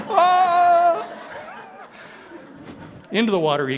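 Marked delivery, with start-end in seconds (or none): 0.1-1.2 falling scream. Acted. Jonah thrown overboard.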